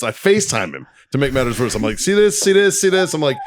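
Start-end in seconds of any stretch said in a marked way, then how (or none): 2.0-3.1 high, rapid-fire pestering, repeated; insistent frustration. Retold or imitated. Re-enacting a FaceTime call about his computer, insistent. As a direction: re-enact pointing out a problem, pitched way up. Acted showing problem on FaceTime.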